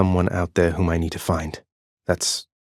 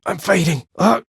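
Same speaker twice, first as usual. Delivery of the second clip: pitched up, near-panicked cries; 3 dB louder than usual. Acted. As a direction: sound like your strength is slipping away. Low health, panicked cries.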